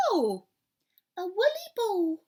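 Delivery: cried out. Acted. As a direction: squeal at the woolly ball. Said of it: "cried the little girl".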